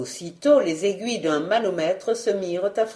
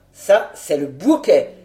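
lower, louder, satisfied male voice; satisfied henchman; 5 semitones lower, 5 dB louder than usual. A narrator's reading. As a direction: relish it in a lower voice. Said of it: "avec une visible satisfaction". (satisfaction appuyée, voix plus grave)

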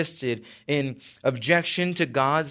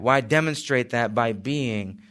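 usual speaking voice

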